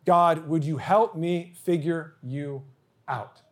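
retold prayer, drawn out word by word; slow, deliberate retold plea to God; slow, pleading prayer. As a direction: repeat the plea slowly, letting each word land. Repeats the retold prayer for weight.

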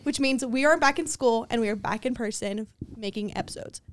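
normal talking voice

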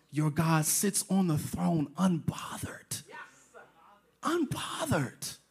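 whispered, slow, calm; soft slow emphasis; hushed and unbothered. Real, not acted. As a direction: let it fall to a whisper. Preacher stresses God's calm.

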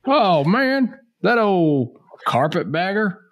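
high, slow Southern colonel voice; Colonel Angus. Pretend. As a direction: ham up an old Southern colonel. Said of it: "I sound like Colonel Angus".

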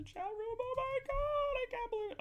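tiny high, soft wail; squeaky mock-distraught voice; mock dismay. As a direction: whine it small and way up high. Mock dismay at being kicked out.

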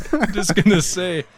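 ordinary talk, nothing marked

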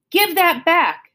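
high, slow furious shout; furious demand. A narrator's reading. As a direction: demand it back, enraged. Woman furious, grabs for book.

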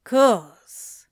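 drawl. A narrator's reading. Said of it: "Anthony drawled".

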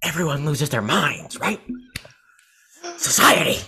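hammed-up Joker, jumping high on the key words. Podcaster retrying the Joker speech for a friend, hammed-up Joker. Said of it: "my Joker voice is better".

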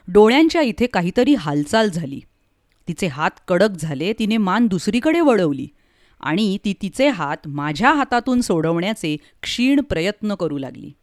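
plain reading voice

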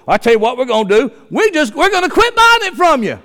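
fast, then a high, hammed-up put-on politician voice; mocking the leaders. Preacher mocks the country's smart leaders to the congregation, hammed-up. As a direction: lampoon the smug leaders in a cartoon voice. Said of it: "Guess how our smart leaders do?".

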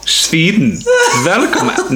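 Nordic attempt slipping into German; German-sounding accent. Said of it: "'cause I go all German".

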